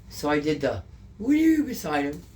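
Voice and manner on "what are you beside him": lightly put-on, high butterfly play; pretend butterfly. Woman re-enacting her butterfly act for a crying man, playful pretend. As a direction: lift it high, as if playing butterfly. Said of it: "pretending you're a butterfly".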